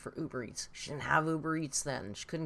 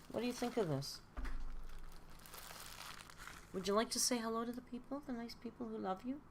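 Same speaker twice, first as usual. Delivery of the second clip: higher and softer pet talk; cooing to the cat; 7 semitones higher, 6 dB quieter, faster than usual. Real, not acted. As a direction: coax the cat gently to say hello. Coaxing the cat.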